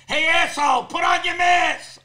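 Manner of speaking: high, loud, slow shout; imitated lady yelling at him; mock angry yell. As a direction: bark it at the man without a mask.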